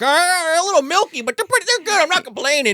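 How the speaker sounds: high mock son; high teen voice. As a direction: chew and mumble like a polite kid. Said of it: "He's like".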